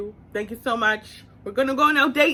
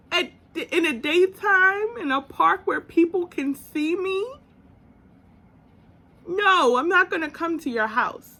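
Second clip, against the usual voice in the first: firm refusal; 7 semitones higher than usual. Retold or imitated. Her firm refusal to him.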